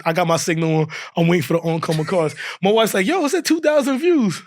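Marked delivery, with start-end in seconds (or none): 3.1-4.4 high-pitched retold amazement; mock excited wife. Wife telling husband about the views while he drives, mock excited. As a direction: imitate her breaking exciting news.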